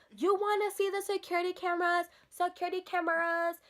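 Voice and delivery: high-pitched mocking imitation; mimicked salon worker. Teller imitating salon worker threatening with cameras, mocking mimicry. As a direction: pitch it up to mock her threat.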